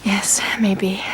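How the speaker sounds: barely voiced uncertainty; whispered half agreement. Real, not acted. Unsure after comforting talk.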